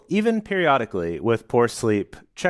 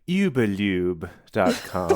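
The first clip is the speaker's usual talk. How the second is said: slow, lower-pitched mock British "yoo-buh-lyoob"; British accent; 4 semitones lower than usual. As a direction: put on an exaggerated British accent, drawn out. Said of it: "or as the Brits would say".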